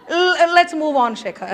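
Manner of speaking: slow, laughing through the words. Real, not acted. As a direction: try to move on while still laughing. Laughing at own joke.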